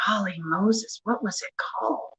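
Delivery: hushed, near-whispered wondering; muttering to self. Real, not acted. Crafter trying to recall a name, hushed muttering. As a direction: mutter it under the breath.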